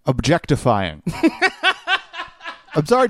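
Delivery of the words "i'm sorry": laughing. Real, not acted. Laughing apology about accent.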